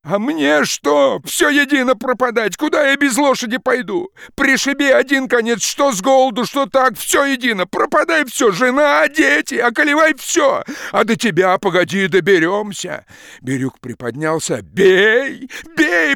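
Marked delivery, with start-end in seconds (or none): 0.1-13.0 high, loud, reckless shouting; nothing left to lose, scream it out; despairing furious yell. Acted. Ruined peasant shouting at the forester, despairing furious yell. 14.6-16.1 high, loud ferocious shout. Acted. Peasant daring the forester to strike, ferocious shout. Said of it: "подхватил мужик свирепым голосом".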